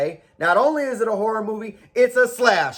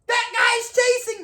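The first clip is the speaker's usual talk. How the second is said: mock panicked victim; 14 semitones higher than usual. Victim voice, much higher.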